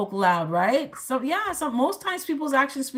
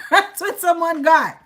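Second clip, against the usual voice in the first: laughing it out, higher and louder; 6 semitones higher, 7 dB louder than usual. Real, not acted. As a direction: chuckle through the words. Laughing over tarot reading.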